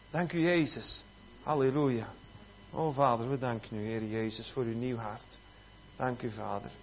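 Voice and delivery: soft, low murmured prayer; hushed thanksgiving to God; prayer of thanks. Real, not acted. Prays thanks aloud.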